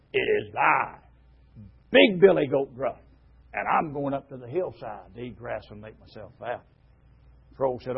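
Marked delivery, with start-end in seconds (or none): 0.1-6.6 low, gruff storybook goat; lightly voiced gruff goat; gruff big billy goat. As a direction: answer as gruffly as a troll. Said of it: "in just as gruff a voice as the troll had, the voice returned".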